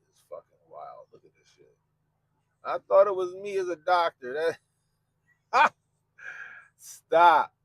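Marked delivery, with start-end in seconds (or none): 2.6-4.6 laughing mock excuse. 5.5-7.5 laughing stop. Real, not acted. Laughing at the picture.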